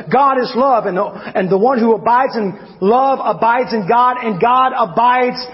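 high, loud sermon build, slowing on key lines; rising preaching. Acted. Preacher reciting Corinthians to the congregation, high ringing sermon voice. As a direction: build the scripture into a ringing climax.

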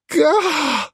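high startled yelp; a sudden shocked cry; startled gasp. A narrator's reading. Rabbi reacts in shock to the challah: high yelp.

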